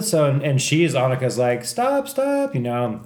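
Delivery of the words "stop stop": very high mock cry to stop; high-pitched retold pleading; imitated frantic plea. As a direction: mimic a woman begging them to stop. Imitating friend pleading with police.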